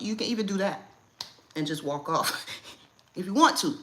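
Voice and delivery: ordinary talk, nothing marked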